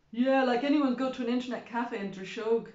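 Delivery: loud heckle from the back of the crowd. A narrator's reading. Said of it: "louder than the rest".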